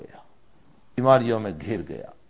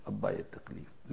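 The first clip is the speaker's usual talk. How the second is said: "ابا یہ تکلیف" breathed out unvoiced, much softer; faint whisper; 12 dB quieter than usual. Frightened daughter whispering to her father at the pit. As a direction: let the plea fade to a whisper.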